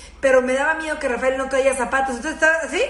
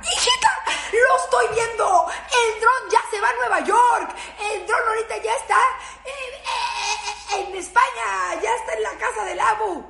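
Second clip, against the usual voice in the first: exasperated yelling; 8 semitones higher than usual. Retold or imitated. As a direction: shout it like the drone is already flying off to another country. Mother yelling at daughter about drone.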